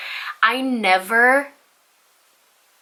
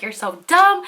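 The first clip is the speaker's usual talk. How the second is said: higher-pitched mock outburst; retold flipping out; 7 semitones higher, 3 dB louder than usual. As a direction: imitate a boyfriend losing his temper. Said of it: "he starts flipping out on me".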